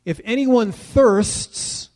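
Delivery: high, slow, ringing call; loud cry out. Retold or imitated. Yeshua calling out to the gathered nations, loud and slow. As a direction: cry it out to the whole crowd. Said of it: "he cries out in a very loud voice".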